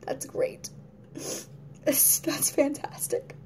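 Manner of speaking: whispered deadpan sarcasm; sarcastic. Retold or imitated. Mocking something great, in a sarcastic whisper. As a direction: breathe it out flat and unimpressed. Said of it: "I'm just like".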